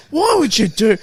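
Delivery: half-hammed disbelief; mock exasperated dad. Dad scolding his son, loud and exasperated.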